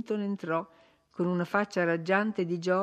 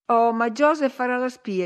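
higher, louder insinuation; insinuating; 6 semitones higher, 7 dB louder than usual. A narrator's reading. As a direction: slip in a pointed warning, pitched up. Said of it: "insinuò lei".

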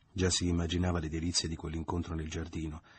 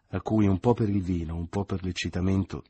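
ordinary delivery, nothing marked

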